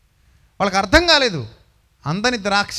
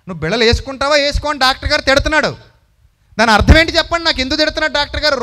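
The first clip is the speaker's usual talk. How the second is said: fast, loud, high mock scolding; the doctor scolds a patient about pills, fast and loud; 6 semitones higher, 6 dB louder, faster than usual. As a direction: bark it like an impatient doctor. Preacher acts scolding doctor.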